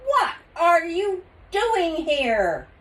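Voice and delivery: high, loud, slow astonishment; shocked to find kids here; surprised chief. Acted.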